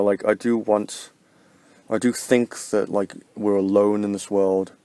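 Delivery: ordinary talk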